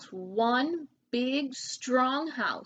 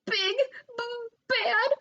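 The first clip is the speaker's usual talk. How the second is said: much higher, stammering in fear; teeth chattering; 12 semitones higher, 6 dB louder than usual. A narrator's reading. Frightened pig stammering about the wolf, terrified. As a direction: stutter it out, shaking with fright. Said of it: "Boris chattered".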